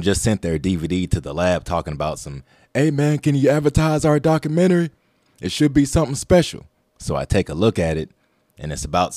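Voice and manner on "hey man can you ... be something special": high quoted voice. Retold or imitated.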